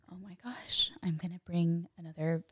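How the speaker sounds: hushed, breathless whisper of realization; awed whisper. Retold or imitated. Woman voicing her private shock at being pregnant, whispered. Realizing she is bringing a human.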